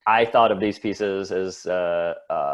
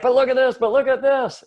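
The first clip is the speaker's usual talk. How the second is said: much higher and louder, gushing; excited pleading; 8 dB louder than usual. Retold or imitated. Alex begging German teammates to look, squealing excitedly. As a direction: squeal it out in wonder.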